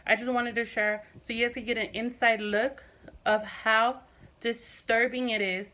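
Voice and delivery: ordinary talk, nothing marked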